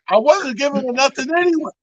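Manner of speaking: pouty comic shrug of a line; sour grapes. Pretend. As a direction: brush her off like you never cared.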